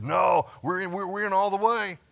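loud, high, eager declaration; Peter's eager shout. Retold or imitated. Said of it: "Peter's answer is".

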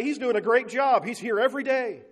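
loud sarcastic praise, pitched high; mock praise. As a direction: scoff at a painter who never finishes. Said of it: "You don't say".

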